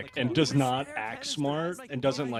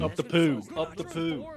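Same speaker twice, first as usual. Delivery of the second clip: mock Liverpool accent; 6 semitones higher than usual. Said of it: "Not if they're from Liverpool".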